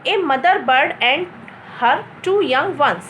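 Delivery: slow, measured reading with a small break after "and"; model reading. Shown as a demonstration. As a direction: read "A mother bird and her two young ones" slowly as one block. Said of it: "हम इसको सही तरीके से कैसे पढ़ेंगे". (सही तरीके से, धीरे-धीरे पढ़ना)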